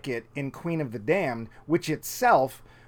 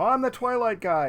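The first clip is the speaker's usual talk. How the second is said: mock quote; 9 semitones higher than usual. Quoting a film line.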